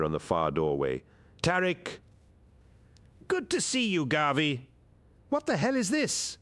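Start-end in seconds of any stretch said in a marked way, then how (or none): 1.4-2.0 high urgent call. A narrator's reading. Calling out in battle. 3.3-4.7 high voiced warrior; character voice. A narrator's reading. Character greeting amid battle. 5.3-6.4 high, alarmed warrior; character voice. A narrator's reading. Character amid chaos.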